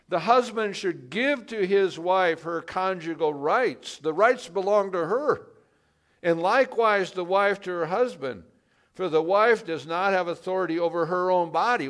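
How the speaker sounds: forceful scripture reading. Acted. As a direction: proclaim the verses to the congregation.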